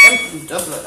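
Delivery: put-on feeble try at an Irish accent; badly faked Dublin accent; Liam Neeson. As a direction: have a clumsy go at an Irish accent. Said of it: "talk in his Liam Neeson accent".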